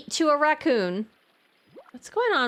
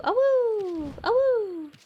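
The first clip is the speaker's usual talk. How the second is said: howling like a wolf pup; 11 semitones higher, much slower than usual. Pretend. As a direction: howl like a little wolf. Wolf character howls in game.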